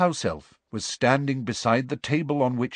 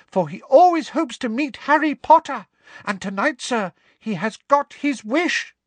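high squeaky house elf; 5 dB louder than usual. Acted. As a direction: squeak it eagerly. Elf voice continues.

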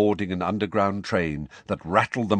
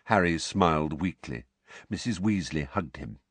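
ordinary delivery, nothing marked

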